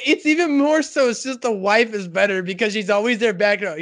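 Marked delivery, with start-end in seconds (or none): none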